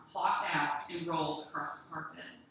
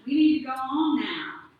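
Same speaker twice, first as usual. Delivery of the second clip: loud urging; 7 semitones higher, 12 dB louder than usual. Acted. Ghost urges her to leave.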